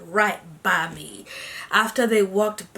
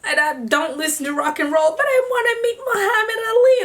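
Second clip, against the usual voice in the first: pleading; 8 semitones higher than usual. Retold or imitated. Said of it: "So I'm like".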